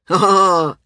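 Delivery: loud, hearty greeting cry; booming. A narrator's reading. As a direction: boom it out. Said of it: "he boomed".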